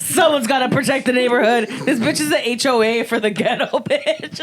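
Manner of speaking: loud, laughing impersonation of a bossy friend; mock bossy neighbour. Friend imitating Stivana rallying neighbours, loud laughing mock bossiness. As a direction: boom it out like the block's self-appointed watch. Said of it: "She's like".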